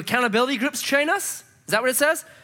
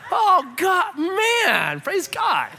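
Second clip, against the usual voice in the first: excited praise; 9 semitones higher, much slower than usual. Real, not acted. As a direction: burst out in joyful praise. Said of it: "preacher exclaiming".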